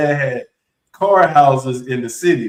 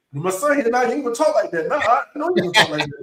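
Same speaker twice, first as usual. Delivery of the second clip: dad calling out his son's arrival, pitched higher; higher, teasing dad voice; boastful dad; 7 semitones higher than usual. Retold or imitated. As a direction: show off the son to the room. Dad bragging about son.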